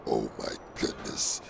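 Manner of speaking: whispered symbiote voice; breathy whispered monster; Venom whisper. Acted. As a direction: rasp it out as the creature in his head.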